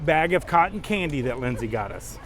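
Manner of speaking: usual speaking voice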